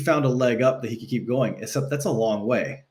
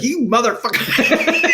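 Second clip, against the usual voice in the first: much higher, slow, drawn-out exasperation; mock outraged dad; 12 semitones higher, 3 dB louder, slower than usual. Father answers son's bragging phone call, mock outraged. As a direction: stretch it out in a put-on voice, half-laughing. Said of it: "He's like".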